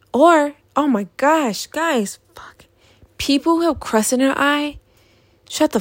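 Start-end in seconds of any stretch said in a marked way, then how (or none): 1.7-2.7 sudden whispered aside; hushed aside to viewers. Real, not acted. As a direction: drop to a breathy whisper for the audience. Aside during disgusted rant.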